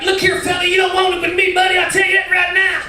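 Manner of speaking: high, shouted cowboy threat; cowboy twang. Retold or imitated. Cowboy snapping after being bumped, shouted cowboy twang. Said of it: "He was a cowboy".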